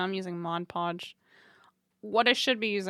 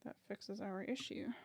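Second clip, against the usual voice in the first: ordinary talk, nothing marked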